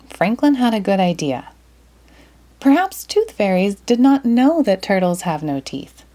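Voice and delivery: usual speaking voice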